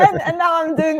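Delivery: high, loud laughing words. Real, not acted. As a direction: laugh through it. Laughing after teasing joke.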